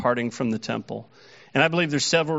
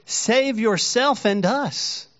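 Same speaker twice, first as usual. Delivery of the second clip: higher, slow jeering challenge; railing taunt; 8 semitones higher, slower than usual. Acted. Criminal on the cross railing at Jesus, slow jeering. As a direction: drag out the taunt, mocking. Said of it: "railed at him".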